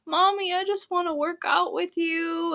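put-on high, pleading little-boy whine; mock a tearful kid begging mom; imitated crying child. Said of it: "he's just crying, like, so besides himself".

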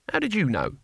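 high-pitched, suspicious question; demanding. A narrator's reading. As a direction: demand an answer, pitch jumping up. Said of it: "he demanded".

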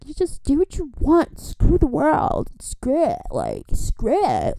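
high airy voice, slow and loose; lightly mock a carefree slacker; dismissive carefree impression; mock carefree American. Mocking carefree American attitude.

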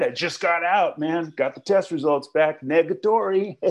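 casual bro bragging; high, offhand bro voice; AA buddy. Retold or imitated. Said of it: "somebody's gonna come up to you and go".

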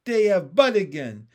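loud, delighted holler; excited shout. A narrator's reading. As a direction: call out the news with glee. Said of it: "shouted Pin".

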